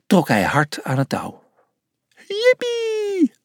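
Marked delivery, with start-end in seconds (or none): 2.3-3.3 very high, drawn-out squeal of joy; gleeful whoop. A narrator's reading. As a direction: cheer as if flung through the air. Nadia launched from the sling.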